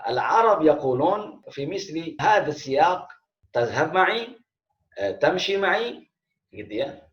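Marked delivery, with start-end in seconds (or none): 3.5-4.3 "Tazhab ma'i" lifted into a higher-pitched question; "Tazhab ma'i" as a spoken question, pitch climbing; question intonation. Shown as a demonstration. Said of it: "intonasi pertanyaan". 5.2-6.0 "Tamshi ma'i" pitched high as a question; "Tamshi ma'i" asked with clearly raised pitch; question intonation. Shown as a demonstration. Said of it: "intonasi pertanyaan".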